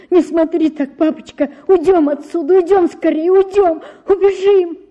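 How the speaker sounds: высокая испуганная мольба, громче; торопливо упрашивает, голос всё выше; в страхе, умоляя. A narrator's reading. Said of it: "терзаясь от страха". (девочка в страхе уговаривает отца бежать)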